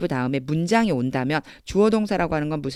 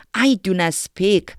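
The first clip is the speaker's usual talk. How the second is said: slow reading; 2 semitones higher, much slower than usual. Shown as a demonstration. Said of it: "그냥 해보세요".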